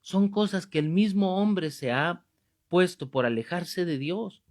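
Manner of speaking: normal talking voice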